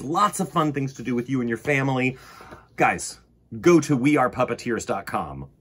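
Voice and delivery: ordinary delivery, nothing marked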